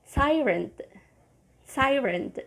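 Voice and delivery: tyrant, TIE-runt; model word. Shown as a demonstration. Teacher modelling tyrant, TIE-runt. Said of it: "you say".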